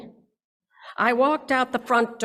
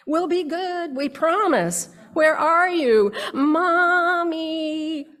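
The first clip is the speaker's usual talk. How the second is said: put-on higher, louder child voices calling out; begging children; 9 semitones higher, 6 dB louder, slower than usual. Children in the yard begging mommy to come back, high pleading calls. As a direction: plead and call for mommy like small kids. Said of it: "my children in the yard begging for me".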